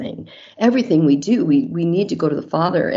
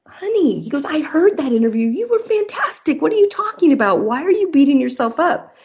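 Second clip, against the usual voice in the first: much higher, light voiced husband reassuring his wife; 10 semitones higher than usual. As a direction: play the sweet husband brushing off her worries, pitched up. Husband reassures wife in retold story.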